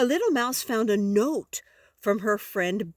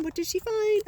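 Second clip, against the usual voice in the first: much higher excited question; 12 semitones higher than usual. Acted. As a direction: pitch jumps up high on the repeat.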